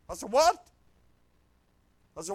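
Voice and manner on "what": sharp, loud, high what; shocked what. Retold or imitated. Preacher stunned the pastor refuses the woman, loud startled. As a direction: disbelief at what he just heard.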